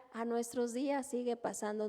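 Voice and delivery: usual speaking voice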